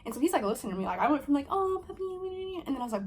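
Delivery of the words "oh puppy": very high, drawn-out coo; cooing at a puppy. Retold or imitated. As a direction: melt over a puppy in a high singsong. Gushing at puppy before switching.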